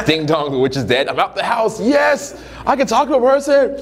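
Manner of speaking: high, sing-song cheer rising to a squeal; hammed-up elation. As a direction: celebrate like a man freed from jail. Divorced guy's joy, imitated.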